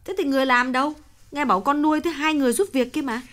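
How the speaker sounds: surprised. A narrator's reading. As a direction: ask back in surprise. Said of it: "Bà mẹ ngạc nhiên hỏi lại".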